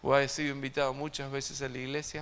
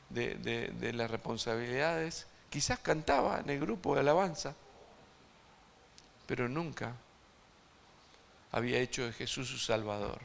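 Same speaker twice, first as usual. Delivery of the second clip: normal talking voice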